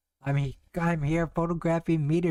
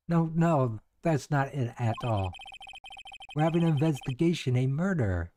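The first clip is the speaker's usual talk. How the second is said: normal talking voice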